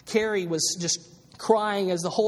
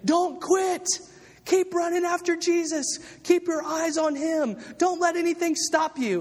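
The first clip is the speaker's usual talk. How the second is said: coach cheering on; 11 semitones higher than usual. Acted. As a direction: pump them up like a coach from the sidelines. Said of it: "Paul is cheering us on as a coach".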